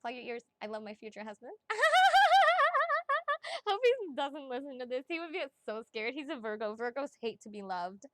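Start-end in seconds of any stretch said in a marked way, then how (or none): 1.6-3.6 long, high, loud squealing laugh; giddy shrieking laugh. Real, not acted. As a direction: explode into a giddy high cackle. Giddy after confessing love.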